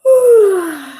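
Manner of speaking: loud, high falling yawn; big yawn sigh. Acted. Pete yawning before bed, loud high sigh. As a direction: let out a sleepy yawning sigh.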